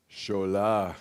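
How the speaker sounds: a hint of a stern African father calling the boy's name; understated fatherly call of the name; dad's calling voice. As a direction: lightly voice the father summoning his son. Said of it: "in a voice that I can do pretty well by now".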